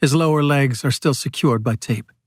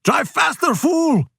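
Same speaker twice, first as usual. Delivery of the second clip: yelling; 13 semitones higher, slower than usual. A narrator's reading. Pelayo to his driver George, yelling. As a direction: yell the order, pitch way up. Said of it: "Pelayo yells to George".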